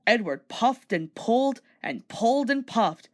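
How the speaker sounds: ordinary delivery, nothing marked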